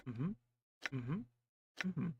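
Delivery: usual speaking voice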